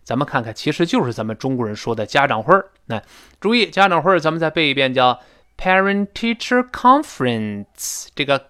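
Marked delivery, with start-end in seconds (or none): 5.6-8.1 "conference" peaks high on "con"; stress on con. Shown as a demonstration. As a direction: slowly build to a high "con" in "conference", then drop. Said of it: "这个con一般读得最高". ("conference"中"con"读得最高)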